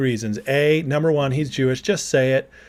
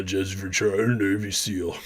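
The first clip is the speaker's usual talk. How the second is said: lower, softer Jesse Ventura impression; 6 semitones lower, 7 dB quieter than usual. Streamer reading chat as Jesse Ventura, low impression. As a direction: read it like Jesse Ventura, low and gruff-toned. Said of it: "I'll try to read it in Jesse voice".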